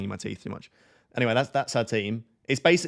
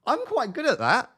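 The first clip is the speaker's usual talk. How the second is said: put-on high girly voice; 13 semitones higher, 6 dB louder than usual. Shown as a demonstration. Ventriloquist girl voice demo.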